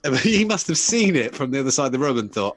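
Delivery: ordinary talk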